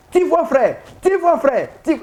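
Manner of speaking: high, mock-persuasive voice; imitating a know-it-all. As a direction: mimic someone lecturing a brother. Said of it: "c'est comme ça qu'ils veulent faire".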